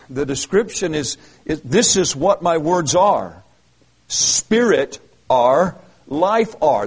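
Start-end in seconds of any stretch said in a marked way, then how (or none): 1.6-6.9 "This is what my words are. Spirit are, life are." spoken slowly, word by word; slow literal reading. Shown as a demonstration. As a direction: spell out the literal order, weighing each word. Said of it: "That's a literal transla".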